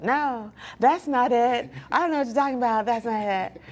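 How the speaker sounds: acted-out defensive brush-off; mock stubborn denial. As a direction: push back like you refuse to hear it. Acting out her denial to mentor.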